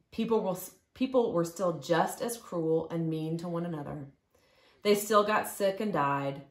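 plain reading voice

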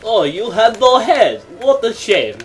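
ordinary talk, nothing marked

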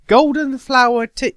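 a loud, appalled "Golden flower tea?"; incredulous. Acted. Undyne outraged at flower tea.

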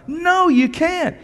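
loud, high insistent preaching; preacher insisting to the congregation, loud; fervent preaching. Real, not acted. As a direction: hammer the no home. Preaching at full force.

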